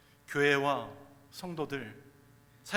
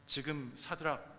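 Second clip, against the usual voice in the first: usual speaking voice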